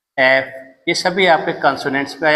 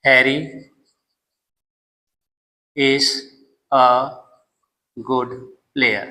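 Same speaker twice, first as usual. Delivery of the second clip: long pauses between "Harry", "is", "a", "good", "player"; dictated word by word; 3 semitones lower, much slower than usual. Shown as a demonstration. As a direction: spell the sentence out slowly for writing. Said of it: "second example आप रख सकते हैं". (शब्द-दर-शब्द लिखवाना)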